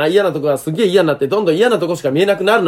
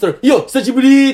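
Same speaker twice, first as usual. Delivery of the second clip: calling out a slow, louder hello across the street; mock loud street greeting; 8 semitones higher, 4 dB louder than usual. Mocking a guy shouting to a stranger, loud call. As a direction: hail an old friend loudly, half in jest. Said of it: "って大きい声で".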